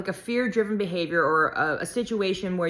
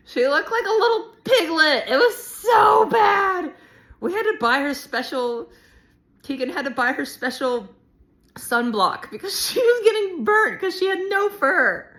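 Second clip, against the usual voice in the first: high, loud squealing through laughter; laughing hard; 10 semitones higher, 6 dB louder than usual. Real, not acted. Vlogger recalling the bald dog's sunblock, shrieking with laughter. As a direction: crack up until the words come out as shrieks. Cracking up over the shaved dog.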